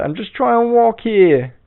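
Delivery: high, loud, drawn-out New York holler; angry New Yorker. Retold or imitated. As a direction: imitate an irate New York pedestrian. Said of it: "most New York thing ever".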